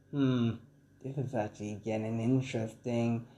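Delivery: quietly intrigued onlooker, lightly acted; intrigued musing. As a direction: muse under your breath. Villain watching the fight, musing.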